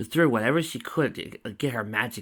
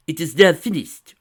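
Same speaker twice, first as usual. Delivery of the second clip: final; 7 semitones higher, 13 dB louder than usual. A narrator's reading. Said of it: "Sakura said with finality".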